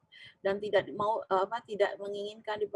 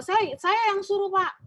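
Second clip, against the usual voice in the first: anxious HR manager; 10 semitones higher, 6 dB louder, slower than usual. Retold or imitated. HR manager defends her to boss.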